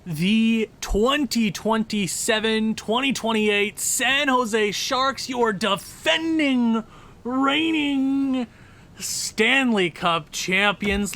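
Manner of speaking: slow, drawn-out showcase announcement; hammed-up slow title-card voice; mock announcer intro. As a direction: present the champions like an arena announcer. Grand announcer-style team introduction.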